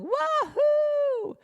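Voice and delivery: a hugely high, gleeful group cheer; joyful whoop. Retold or imitated. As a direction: whoop it out with pure delight. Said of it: "Three hundred people all going".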